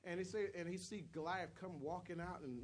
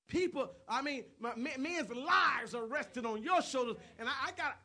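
raised, insistent pulpit voice; full-force preaching; 8 semitones higher, 9 dB louder than usual. Acted. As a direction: press the warning home loudly. Preaches acting out Saul.

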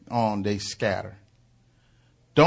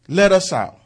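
mild demon scream; 6 semitones higher, 5 dB louder than usual. Retold or imitated. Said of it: "they screamed".

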